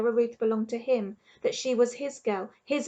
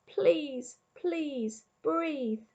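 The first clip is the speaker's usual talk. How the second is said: desperate plea; 5 semitones higher, 3 dB quieter, much slower than usual. A narrator's reading. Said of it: "thought Edward".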